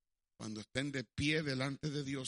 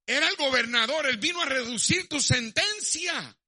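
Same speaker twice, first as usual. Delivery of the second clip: loud, high, driving sermon storytelling; thundering preacher telling a story; full-force preaching; 11 semitones higher, 9 dB louder than usual. Acted. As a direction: preach the story out to the whole hall.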